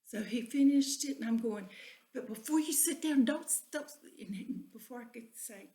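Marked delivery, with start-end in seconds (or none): 2.1-3.9 higher, louder urgent warning. Retold or imitated. Wife warning husband not to sit on the hook, urgent. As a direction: rush to stop him, pitched up.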